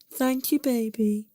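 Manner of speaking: high, slow, fake-sweet thanks. A narrator's reading. Girlfriend thanking boyfriend for a cheap gift, fake tone. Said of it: "with a fake tone".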